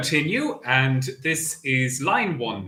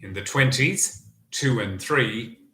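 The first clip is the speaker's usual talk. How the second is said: bingo caller. Acted. Bingo number calling.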